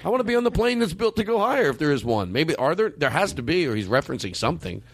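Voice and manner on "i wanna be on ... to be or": higher, exasperated rant; incredulous and worked up. Real, not acted. Exasperated rant about pilot.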